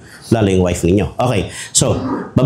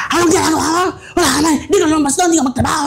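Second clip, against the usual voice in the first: higher, mock scolding wife; nagging wife; 9 semitones higher, much faster than usual. Preacher imitating a wife nagging her jobless husband, mock scolding. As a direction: scold him like an exasperated wife.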